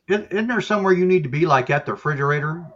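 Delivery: high, fast, cheeky dog voice; talking dog voice. Retold or imitated. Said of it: "Scooby's like".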